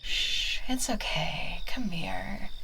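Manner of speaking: hushed, gentle, drawing them close; soothing whisper. Acted. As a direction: shush a frightened pet. Witch soothes cat in whisper.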